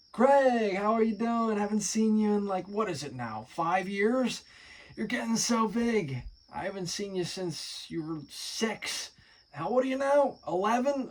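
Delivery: high, loud, delighted greeting; a high excited uncle voice. Acted. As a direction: gush over the nephew like a thrilled uncle.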